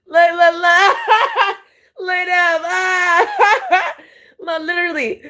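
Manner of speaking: high, loud laughing shouts. Real, not acted. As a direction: shout the words through hysterical laughter. Laughing fit at video.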